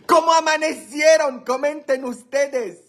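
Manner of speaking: high, ringing greeting; excited call-out. Real, not acted. Host urging viewers to comment, excited high call-out.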